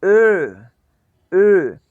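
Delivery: ए said with rounded lips for o-umlaut; o-umlaut demo. Shown as a demonstration. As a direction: round the mouth on ए. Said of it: "हाँ, try".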